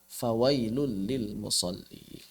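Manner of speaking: drawn-out Quranic chant; slow recitation. Shown as a demonstration. Teacher reciting the verse slowly for students.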